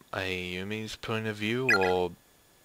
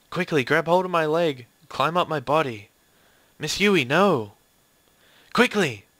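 higher, louder urgent commands; urgent alarm; 5 semitones higher, 5 dB louder than usual. Acted. Dangling teacher and student in danger, urgent raised cries. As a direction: cry out in a sudden emergency. Acting a cliffhanging rescue.

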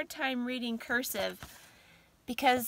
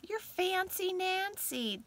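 playful praise; 8 semitones higher, slower than usual. Real, not acted. Admiring the bracelet.

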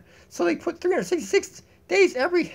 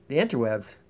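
"The interwebs" dropped very low and soft; deep goofy echo of "The interwebs"; 6 dB quieter than usual. Shown as a demonstration. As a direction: drag "The interwebs" down into a deep growl. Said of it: "look it up in the interwebs".

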